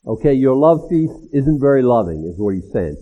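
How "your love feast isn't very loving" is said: usual speaking voice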